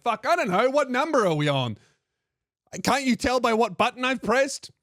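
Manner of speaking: high-pitched, exasperated comeback; flustered reply. Retold or imitated. Caller to conductor asking the carriage number, acted fluster. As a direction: answer back in a retold fluster.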